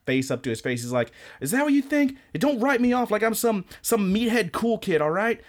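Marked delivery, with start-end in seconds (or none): 1.4-5.4 high-pitched, exaggerated ranting schoolboy; mock angry rant. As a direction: rant it high like a wounded teen. Retelling anime character's angry rant.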